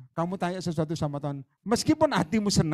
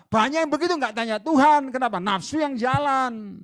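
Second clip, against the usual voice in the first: stern rebuke; 8 semitones higher, 5 dB louder than usual. Acted. Preacher rebuking the couple.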